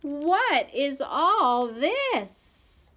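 slow, high, drawn-out question; high, bewildered slow exclamation; astonished mother. A narrator's reading. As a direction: stretch each word in amazement. Mama shocked by the mess.

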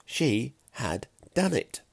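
ordinary delivery

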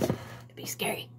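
whispered aside; hushed joking aside. Real, not acted. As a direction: drop to a whisper for the joke. Joking aside while baking.

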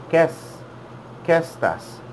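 "Qué" stressed, then "estás" falling away; stress on first syllable. Shown as a demonstration. Teacher models the right way for repeaters, stress on "Qué". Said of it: "Make a stress sound in the first syllable".

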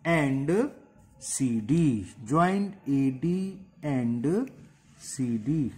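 slow, spelled-out dictation. Shown as a demonstration. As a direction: dictate slowly while writing. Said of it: "करें बस last step".

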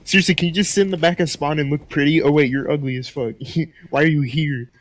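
ordinary delivery

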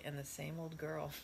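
added softly. A narrator's reading. Wise finding the hidden co-ed, added softly. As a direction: say it softly, dropping pitch and volume. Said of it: "added softly".

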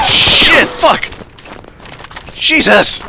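high sharp yelp of alarm; startled shout. Real, not acted. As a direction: cry out as something explodes. Under fire, sudden blast.

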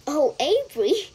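high, loud, amused exclamation; chuckling. A narrator's reading. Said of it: "chuckled Missus Serval".

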